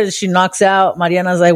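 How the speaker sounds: ordinary talk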